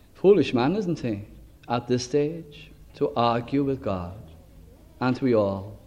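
ordinary talk

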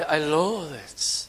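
slow, soft, heavy "loathe" drawn out with disgust; stress on loathe. Shown as a demonstration. Preacher showing his flock how strong loathing sounds, slow stress on "loathe". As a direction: lean into "loathe" slowly and quietly. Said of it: "it means you can't even look at it".

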